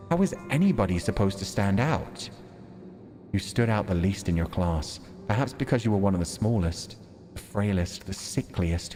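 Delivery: normal talking voice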